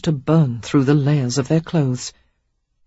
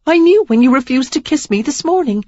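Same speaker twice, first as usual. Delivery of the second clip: hurt woman; 10 semitones higher, 6 dB louder than usual. A narrator's reading. Sarah hurt and accusing.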